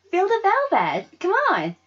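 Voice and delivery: put-on high, loud coaxing girl voice; eager Sarah. Sarah urging Wynonna to feel the velvet, eager coaxing. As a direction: coax her to touch it. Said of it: "said Sarah".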